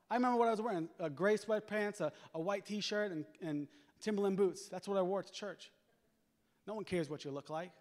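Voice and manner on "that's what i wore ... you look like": usual speaking voice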